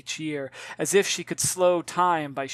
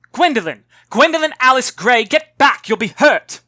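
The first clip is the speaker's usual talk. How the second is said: loud, higher, frantic mother's call; alarmed shout; 8 semitones higher, 14 dB louder than usual. Acted. Mother shouting her daughter back from the river, frantic and loud. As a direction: yell her full name to stop her. Mother calls child from danger.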